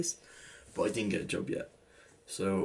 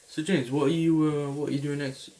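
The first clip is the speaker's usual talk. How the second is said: higher, louder prying uncle voice; teasing uncle; 7 semitones higher, 6 dB louder than usual. Retold or imitated. Uncle probing his nephew, a higher, louder teasing voice. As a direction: fish for the secret in a higher, louder voice.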